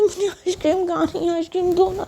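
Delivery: a small whimpering demand; whiny plea. Acted. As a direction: beg for ice cream like a sulking child. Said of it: "तो बोलते".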